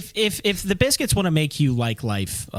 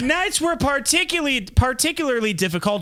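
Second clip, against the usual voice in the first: dramatic narrator voice pitched up high; mock documentary narrator; 7 semitones higher than usual. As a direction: ham it up like a nature documentary.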